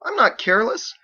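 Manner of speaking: put-on high, loud boyish protest. Edward protests to Michael, high and loud. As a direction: push back, stung.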